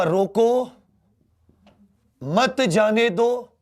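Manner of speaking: रोको, then a slow मत जाने दो; pause after रोको. Shown as a demonstration. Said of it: "मैं तीन तरीके से लिखूंगा".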